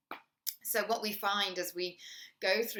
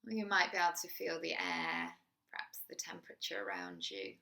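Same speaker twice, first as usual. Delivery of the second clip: guided meditation; 4 dB quieter than usual. Acted. Teacher guiding a listener through a body scan, soft meditation voice. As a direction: ease the listener into the body, gently.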